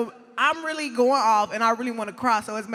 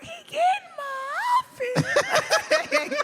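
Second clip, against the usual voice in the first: squeaky, much higher, slow, laughing in disbelief; shocked disbelief; much slower than usual. Retold or imitated. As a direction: squeal it out, half laughing, half crying. Disbelief at EJ missing outfit.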